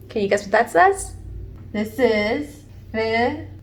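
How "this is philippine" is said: ordinary talk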